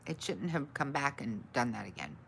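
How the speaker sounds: ordinary talk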